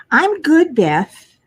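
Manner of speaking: ordinary talk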